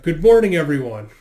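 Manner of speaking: acted host's booming greeting; calling out greeting. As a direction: hail the whole room. Host calls out to everyone.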